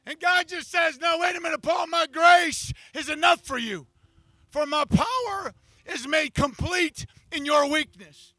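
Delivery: loud, driving sermon voice; preaching at full force. Acted. Pastor preaching God's answer, full force.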